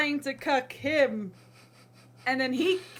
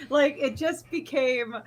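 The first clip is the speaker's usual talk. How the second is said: usual speaking voice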